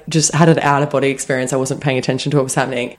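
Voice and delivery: ordinary delivery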